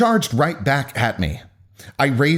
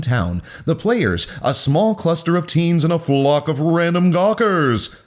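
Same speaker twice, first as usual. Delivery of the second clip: lifted, theatrical show-opener delivery; grand announcer with swooping emphasis; 5 semitones higher than usual. Acted. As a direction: introduce it like the host of a stage spectacle. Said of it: "so proud of my announcer voice".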